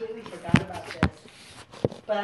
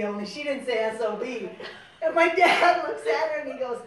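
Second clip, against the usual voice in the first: usual speaking voice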